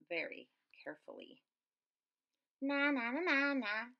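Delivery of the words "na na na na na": high, louder sing-song na-na; snorting taunt. A narrator's reading. Flugel bird mocking the squirrels, high snorting taunt. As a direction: tease in a high, mocking chant. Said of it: "snorted the flugel bird".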